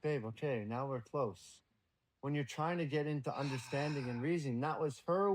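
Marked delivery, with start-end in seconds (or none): 0.0-1.6 soft reassurance. Retold or imitated. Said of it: "she would just wanna be held".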